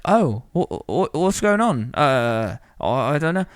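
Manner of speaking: high, flustered Cockney voice; Cockney accent. Retold or imitated. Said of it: "odd man who has a Cockney accent".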